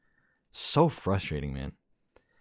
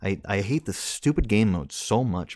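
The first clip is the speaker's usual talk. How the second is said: ordinary talk, nothing marked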